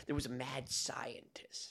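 hushed mock-spooky whisper; storyteller whisper. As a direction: breathe it like a campfire tale.

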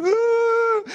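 short high sobbing "Ah"; a high, loud overwhelmed cry; retold sobbing cry. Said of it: "Why are you crying?".

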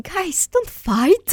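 high, drawn-out pleading; mock plea. Fan to warring fandoms on Twitter, pleading. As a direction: plead with them as peacemaker. Retold plea to fighting fans.

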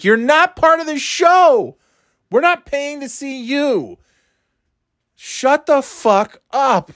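high, slow, drawn-out rebuke; exasperated scolding. Acted. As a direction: tell off the hecklers, stretching the words. Acted scolding of interrupting audience members.

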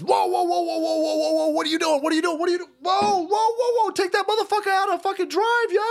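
high, urgent mock shout; retelling the cop's panicked yell; imitated cop yelling. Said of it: "The cop says".

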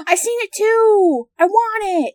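high, gasping excited squeal. Real, not acted. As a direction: squeal with delight. Gasping excitement over bedazzler.

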